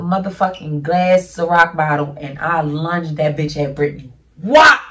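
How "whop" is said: loud, high "whop" impact noise; imitated smack. Telling how the thrown bottle hit, loud imitated smack. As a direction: punch out the sound of a hard hit.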